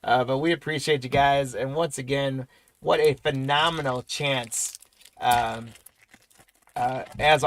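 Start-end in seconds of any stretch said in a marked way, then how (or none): none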